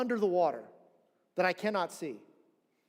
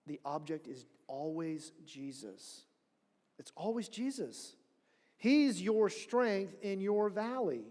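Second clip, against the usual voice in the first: ordinary talk, nothing marked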